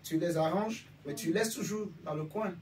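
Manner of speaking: ordinary talk, nothing marked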